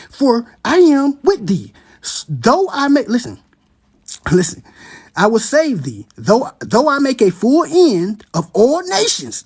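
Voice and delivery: slow, drawn-out proclamation; preacher driving the verse home; preaching scripture. Acted. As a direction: lean into every word. Reads the prophecy, preaching.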